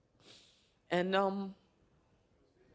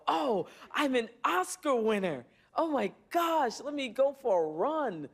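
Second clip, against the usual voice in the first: higher, bright mock gush of a self-impressed star; mock self-important winner; 7 semitones higher than usual. Mocking the winner she refuses to be, higher mock gush. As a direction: play it up like someone dazzled by her own Oscar. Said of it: "kinda wake up and go".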